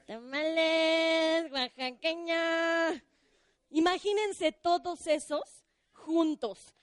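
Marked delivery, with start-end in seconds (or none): 0.1-3.0 slow, drawn-out street vendor cry; tamale vendor call. Retold or imitated. Storyteller imitating the tamale vendor's recorded cry, slow and drawn out. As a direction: stretch "Tamales, oaxaqueña" like the tamale cart. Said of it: "¿Cómo le hacen los tamales?".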